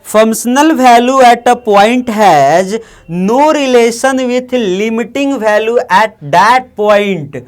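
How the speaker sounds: slow dictation. Shown as a demonstration. Teacher reads note slowly aloud.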